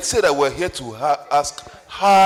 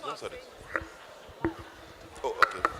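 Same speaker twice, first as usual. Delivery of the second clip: slow, voiceless whisper; whispered aside; 4 semitones lower, 15 dB quieter, slower than usual. Real, not acted. Moderator murmuring privately to someone nearby, slow whisper. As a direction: breathe it off to the side.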